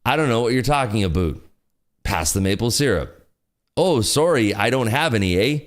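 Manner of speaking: jokey fake Canadian with rounded "aboot"; bad Canadian accent. Streamer acting out Canadians at dinner, bad Canadian accent. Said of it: "has a Canadian accent".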